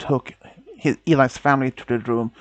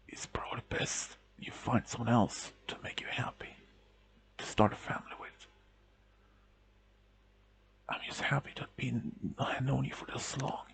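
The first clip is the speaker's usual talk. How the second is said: weak whisper; 3 semitones lower, 15 dB quieter than usual. A narrator's reading. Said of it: "words didn't come out strong enough".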